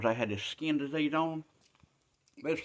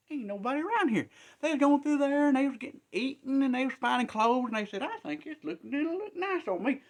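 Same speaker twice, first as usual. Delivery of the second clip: much higher, giddy lepers finding loot; excited high voices; 13 semitones higher than usual. Acted. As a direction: squeal with delight at the treasure. Lepers find enemy camp.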